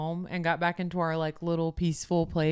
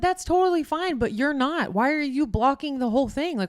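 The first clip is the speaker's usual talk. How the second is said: high-pitched, heated challenge; retold indignant argument; 7 semitones higher, 4 dB louder than usual. Traveller retelling confronting a man blocking the walkway, indignant. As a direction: re-enact arguing back, pitched well up.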